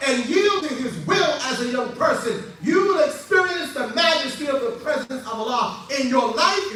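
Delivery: impassioned preaching at full force. Real, not acted. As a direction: proclaim it with rising fervour.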